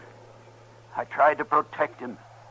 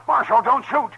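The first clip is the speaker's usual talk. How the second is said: pleading call; 12 semitones higher, 10 dB louder than usual. Acted. Old man calling from hiding.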